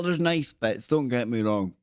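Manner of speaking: normal talking voice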